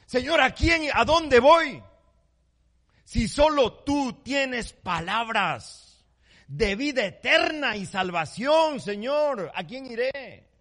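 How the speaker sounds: high, loud, slow pleading cry; Peter's desperate plea. Retold or imitated. Peter crying out to the Lord, pleading and loud. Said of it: "Y Pedro le responde".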